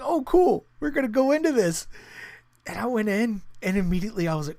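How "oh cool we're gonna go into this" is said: higher and louder, playing naive excitement; mock eager. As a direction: sound thrilled like you have no idea what's coming.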